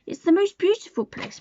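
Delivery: high, indignant protest; Mary protesting to Colin. Acted. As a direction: protest it with feeling. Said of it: "protested Mary".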